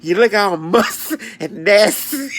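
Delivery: high, loud, slow, disgusted mock scold. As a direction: drag out the insult with disgust. Insulting strangers, retold.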